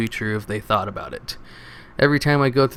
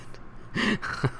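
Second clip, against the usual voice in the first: high, quiet, breathy giggle; laugh; 10 semitones higher, 9 dB quieter than usual. Real, not acted. Reader amused by vague posts, quiet high giggle. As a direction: giggle helplessly at the joke.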